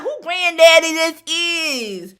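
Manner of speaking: slow, loud, laughing disbelief; loud mocking question. Mocking the divorced date, loud and amused. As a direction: call out the old-looking date. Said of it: "I said".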